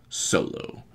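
soft whispered name; villain's hushed address. Retold or imitated. Villain facing his father, quiet whispered acknowledgement.

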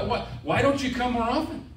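ordinary delivery

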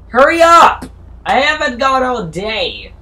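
very loud, high impatient shout; hammed-up impatient customer; impatient yell. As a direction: hurry someone up. Imitating impatient passenger.

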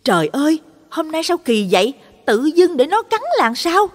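alarmed exclamation. A narrator's reading. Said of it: "bà Dân cất tiếng lên".

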